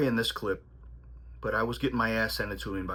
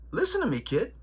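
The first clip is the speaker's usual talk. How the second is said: put-on much higher, stern old trainer voice; imitating his trainer; 10 semitones higher than usual. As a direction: channel the old-school trainer laying down the law. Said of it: "he said".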